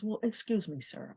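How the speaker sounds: dropped, softened "Well, excuse me, sir". Retold or imitated. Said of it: "let me lower my voice".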